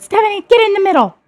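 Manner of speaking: high, loud, quick mock mom order; mom scolding. Mother in front seat scolding her daughter, high sharp call. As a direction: snap it like an annoyed mother. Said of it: "and she said".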